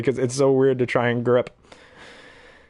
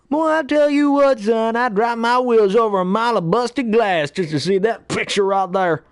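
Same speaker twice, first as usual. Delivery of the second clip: Mater; 9 semitones higher than usual. Acted. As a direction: hoot it like a cartoon hillbilly. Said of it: "I only remember Mater".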